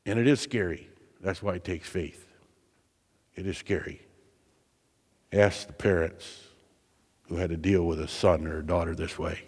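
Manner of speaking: soft, low, sober aside on a hard truth; hushed and grave. Acted. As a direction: drop almost to a hush and let it weigh. Sober turn after rhetorical peak.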